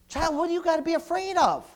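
high, loud, rising preaching; full-force preaching. Acted. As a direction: rebuke the fearful, building.

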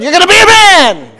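loud mock mob-boss command; lightly voiced Godfather giving orders; stern Godfather. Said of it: "Just like the Godfather said".

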